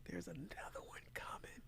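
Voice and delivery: hushed ominous whisper; whispered warning that another knockdown is coming. Real, not acted.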